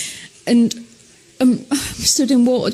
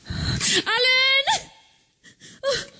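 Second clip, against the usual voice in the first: 1 panicked calling; 11 semitones higher, 4 dB quieter, much slower than usual. Real, not acted. Calling for help in the dark.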